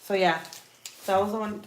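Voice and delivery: normal talking voice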